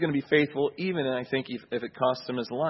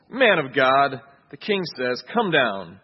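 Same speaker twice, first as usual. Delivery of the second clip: louder, scornful order; mocking command; 7 dB louder, slower than usual. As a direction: sneer it like a captain ordering. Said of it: "the kind of mocking tone".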